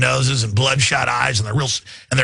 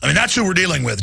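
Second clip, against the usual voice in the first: normal talking voice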